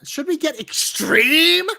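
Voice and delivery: loud, drawn-out hype on "extreme"; hyped lead-in. Real, not acted. Host hyping the show to listeners, hyped and drawn out. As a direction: stretch "extreme" out, rising high.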